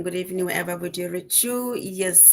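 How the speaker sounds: usual speaking voice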